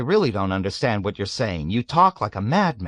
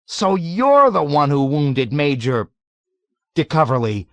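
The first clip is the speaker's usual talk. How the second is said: horrified indignation; 7 semitones higher, 7 dB louder than usual. A narrator's reading. Nately accuses the old man, horrified and indignant. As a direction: recoil and accuse. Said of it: "exclaimed in horrified indignation".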